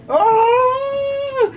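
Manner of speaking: sharp high cry of pain from a bite; pained yelp. Real, not acted. Bitten sister yelps in pain, high and loud. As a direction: yelp as the teeth sink in. Bitten during biting challenge.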